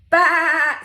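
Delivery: loud, high, wobbly baa; drawn-out sheep call for kids; sheep bleat. Acted. Voicing the sheep.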